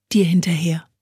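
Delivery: ordinary delivery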